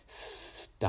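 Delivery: スー as a long hiss with no voice; voiceless sss. Shown as a demonstration. Teacher showing a voiceless スー with hand on throat. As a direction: hold スー out as a breath-only s. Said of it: "スーって発音しても".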